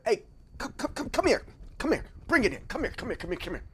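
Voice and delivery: high, soft, beckoning "come here"; mildly hushed coaxing of a general; coaxing call-in. Retold or imitated. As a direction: wave him in gently, over and over.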